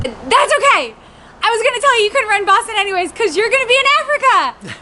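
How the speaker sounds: high-pitched cheering-up; imitated upbeat consolation. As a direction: re-enact brightly consoling a spouse. Cheering up husband at finish.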